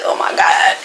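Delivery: whispered exasperation; voiceless, worn-out retold groan; irritated. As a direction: sigh it out under the breath. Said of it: "I'm getting irritated at little stuff".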